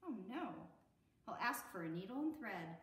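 plain reading voice

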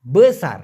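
বেসার said loud and clear, be-sar with open e; correct Malay pronunciation. Shown as a demonstration. Teacher drilling students on বেসার, loud and clear. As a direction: stress বেসার firmly as be-SAR. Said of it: "মূলত সঠিক উচ্চারণটা হবে বেসার".

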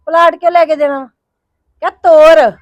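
furious shout building; angry shout. Acted. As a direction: fling the words at her in fury. Sister angrily confronting sister-in-law.

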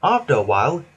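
usual speaking voice

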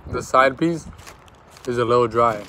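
words around food; chewing while talking. Real, not acted. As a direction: mouth partly full. Seen 0: Right speaker's lips and jaw move like chewing after an earlier bite.

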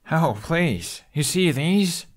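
put-on a teen rolling his eyes at his friend; Hiccup voice. Hiccup showing his teeth.

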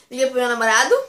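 normal talking voice

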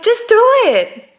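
laughing while hammering out just do it; exasperated director. Retold or imitated. Director urging Marvin to just do the take, exasperated. Director pushing actor to act.

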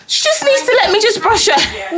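high, strained mock cry of a frazzled mum; mock exasperated mum. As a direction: whine it like a mum at the end of her rope. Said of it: "My mum would be like".

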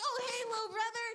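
high, quick offhand greeting; David's brothers. Acted. Older brothers greeting little David, high and quick. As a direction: toss back a quick, high-voiced hello.

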